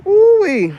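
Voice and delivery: high, loud whoop; excited ooh-wee. Real, not acted. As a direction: let out a delighted whoop. Excited exclamation.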